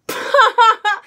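very high, loud shriek of laughter; burst of laughter. Real, not acted. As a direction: crack up helplessly. Stranger's flirt makes her crack up.

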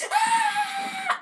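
sudden high-pitched shriek; high scream. Real, not acted. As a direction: let out a startled shriek. Scream in game.

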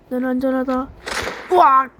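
ordinary talk, nothing marked

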